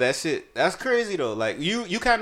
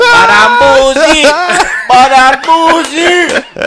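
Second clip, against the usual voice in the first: high, loud, stretched-out yell; hammed-up yelling; 11 semitones higher, 13 dB louder, much slower than usual. Storyteller reliving losing his money, hammed-up yelling. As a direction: holler it slowly, way up high. Acted outburst in retold club story.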